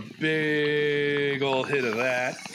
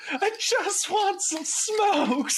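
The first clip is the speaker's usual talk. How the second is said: high, slow wail of despair; breaking down; much slower than usual. Retold or imitated. Customer breaking down at the counter, high slow wail. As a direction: stretch it out like a man at the end of his rope. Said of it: "breaks down at the counter".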